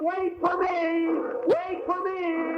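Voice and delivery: high, slow yelling after a leaving train; desperate shout. Acted. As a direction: call out running for the train. Running after departing train.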